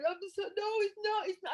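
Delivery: high, flustered denial; flustered headmaster. Retold or imitated. Headmaster denying to a crying child. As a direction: stammer out a nervous denial. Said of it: "the headmaster going".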